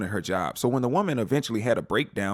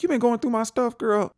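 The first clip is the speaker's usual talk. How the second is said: voicing Dex; 12 semitones higher than usual. Dex confronting Deb, voiced.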